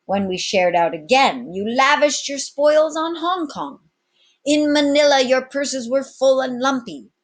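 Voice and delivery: loud, high swaggering captain; commanding captain. Acted. Captain addressing his pirates, loud and bold. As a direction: address the crew like an old sea dog.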